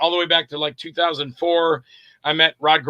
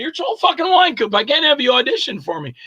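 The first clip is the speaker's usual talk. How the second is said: high-pitched mock protest; incredulous Nick; 7 semitones higher than usual. Director refusing to audition a known actor, mock incredulous. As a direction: imitate an exasperated director refusing outright.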